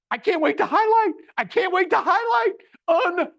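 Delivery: high, loud squeal of delight; excited. Shown as a demonstration. Host showing attendees his surprise, squealing with delight. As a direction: gush with thrill over highlighting. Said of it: "my surprise would be".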